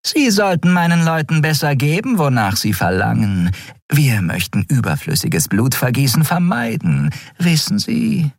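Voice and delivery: high purring villainess. Acted. Shiar purrs behind the admiral's back, menacing. Skria amused, mocking.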